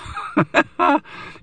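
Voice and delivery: high burst of real laughter; laughing. Real, not acted. Collector laughing at his own Wolverine voice, high giggle. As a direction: crack up at your own impression.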